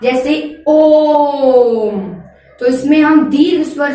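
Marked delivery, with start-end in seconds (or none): 0.7-2.0 ओम held very long and slow, chanted; prolated vowel. Shown as a demonstration. Teacher demonstrating the prolated vowel with ओम. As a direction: stretch ओम far longer than a long vowel. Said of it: "अधिक से भी अधिक समय".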